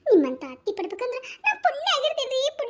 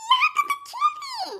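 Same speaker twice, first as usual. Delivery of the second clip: mock crying; much slower than usual. Fake crying comic wail.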